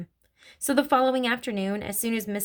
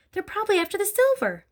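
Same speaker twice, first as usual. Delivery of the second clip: high-pitched, anxious insistence; urgent hiss; 12 semitones higher, 3 dB louder than usual. A narrator's reading. Mother fears for the silver, hissing urgently. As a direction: hiss it high, pressing him to act. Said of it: "hissed the mother".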